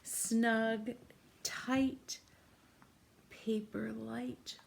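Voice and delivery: ordinary talk, nothing marked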